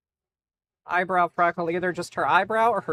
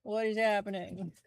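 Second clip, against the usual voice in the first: dog's voice; 2 semitones higher, 3 dB quieter than usual. Pretend. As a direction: play the confused dog. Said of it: "He says".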